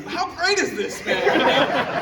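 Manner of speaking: high, loud delighted exclamation; excited dad. Retold or imitated. Dad slapping his kid's arm at the game, excited. Acting dad cheering in cheap seats.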